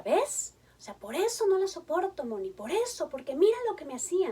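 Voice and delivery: high, loud, pained complaint; pained outburst. Retold or imitated. Retold father to therapist, high and loud with pain. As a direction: voice a hurt father pouring out old pain. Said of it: "lo decía con muchísimo dolor".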